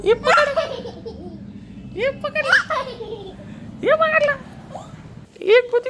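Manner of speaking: very high, excited play voice; playful squeal. Real, not acted. Owner chasing the pet in high playful squeals. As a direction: squeal it like playing chase.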